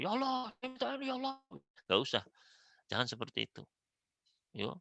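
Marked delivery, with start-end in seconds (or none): 0.0-1.4 much higher, fast, squealing demand; mock someone shrieking at God; hammed-up whiny yelling prayer; mock shouting prayer. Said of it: "jangan teriak-teriak".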